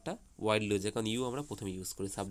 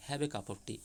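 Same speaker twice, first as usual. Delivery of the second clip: "Have a cup of tea" said much softer, as a gentle request; 6 dB quieter, much slower than usual. Shown as a demonstration. Teacher showing how a soft voice turns it into a request. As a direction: ask "Have a cup of tea" softly, like a kind invitation. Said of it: "আমি যদি নরম গলায় বলি".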